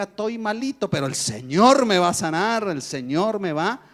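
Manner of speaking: declaring faith. Retold or imitated. Doctor teaching patient to declare faith, slow and fervent. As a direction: proclaim healing with conviction.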